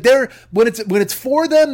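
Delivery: ordinary delivery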